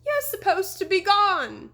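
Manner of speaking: high, loud and slow panicked wail; terrified. A narrator's reading. Deputy wailing at the returned horseman in terror. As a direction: plead in terror at the ghost.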